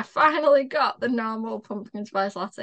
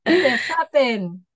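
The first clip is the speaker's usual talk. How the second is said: slow, louder mock Welsh accent; bad Welsh accent; 2 semitones higher, 8 dB louder, much slower than usual. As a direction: drag out Biffa bin in a bad Welsh accent. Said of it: "That's a shit Welsh accent".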